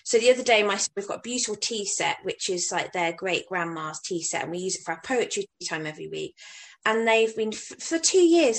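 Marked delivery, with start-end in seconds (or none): none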